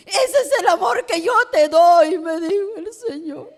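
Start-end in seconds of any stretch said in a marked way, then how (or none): 0.1-2.2 raised, loud voice quoting the Lord; emphatic divine declaration. Retold or imitated. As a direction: proclaim it fervently, high and loud. Voicing God's emphatic words to her. (proclamación ferviente, con voz alzada)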